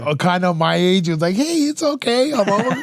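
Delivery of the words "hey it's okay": put-on high girlish voice, soothing; calm the scared rider in a girl's voice; cowgirl reassuring him. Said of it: "a girl, cowgirl".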